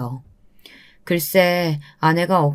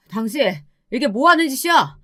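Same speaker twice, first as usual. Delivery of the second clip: higher, outraged shout at his wife; husband shouting; 6 semitones higher than usual. Acted. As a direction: catch her in the act and shout. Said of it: "아내에게 소리쳤어요". (아내에게 버럭 소리치는 남편)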